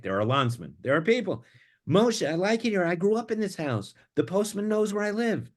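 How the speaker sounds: stubborn wife refusing to move; Chana. Acted. Voicing Chana in the reading.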